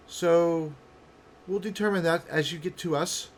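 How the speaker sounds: high, loud, measured woman's voice; wary female character. Acted. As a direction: call down suspicion with deliberate weight.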